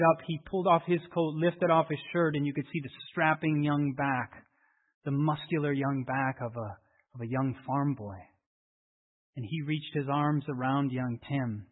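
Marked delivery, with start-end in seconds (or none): none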